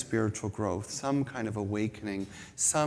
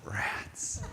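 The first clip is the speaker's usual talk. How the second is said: whispered thought; 6 dB quieter than usual. Retold or imitated. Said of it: "And I thought".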